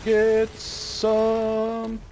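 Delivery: high, drawn-out game taunt; playful battle cry. Pretend. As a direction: hold each word long and high. Battle cry during gameplay.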